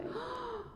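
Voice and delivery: sharp high gasp of shock. Acted. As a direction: gasp in disbelief. Shocked reaction to quoted remark.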